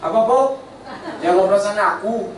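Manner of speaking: high, laughing retort; teasing friend. Retold or imitated. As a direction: toss the plea back with a grin. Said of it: "Temanku itu bilang apa?".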